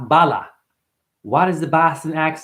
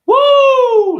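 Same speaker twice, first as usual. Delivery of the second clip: a sudden high whoop, much louder; whooping shout; 9 dB louder than usual. Real, not acted. Ranting to viewers, bursts into a loud whoop. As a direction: let out a wild woo. Excited outburst mid-rant.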